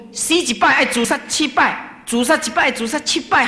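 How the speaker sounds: high, forceful warning; insistent preacher's warning, pitched high; emphatic quoted warning. Retold or imitated. Quoting the master's warning against suicide.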